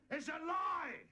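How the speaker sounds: woman shouting down the tramp, hammed-up and loud. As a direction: cry it out as the outraged woman. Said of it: "the woman shouted".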